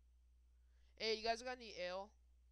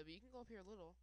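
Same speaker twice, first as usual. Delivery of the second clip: ordinary talk, nothing marked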